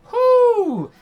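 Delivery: high loud whoop; excited whoop. Real, not acted. Excited cheer while playing.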